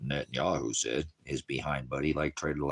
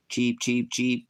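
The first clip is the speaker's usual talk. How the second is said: higher, louder chirping cheeps; bird chirps; 7 semitones higher, 4 dB louder, much slower than usual. Pretend. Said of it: "I don't speak bird".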